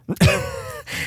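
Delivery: squealing laugh; loud high laugh; high burst of laughter. Real, not acted.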